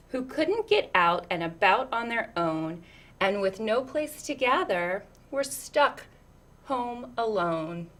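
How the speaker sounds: lilting, rhythmic read-aloud; picture book reading. Acted. Reading a picture book to children, lilting storyteller voice.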